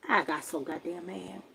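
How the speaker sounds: usual speaking voice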